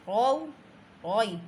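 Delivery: औ, ओई with a thick, heavy र. Shown as a demonstration. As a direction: make the र in औ ओई full and fat. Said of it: "रॉ को मोटा करना है".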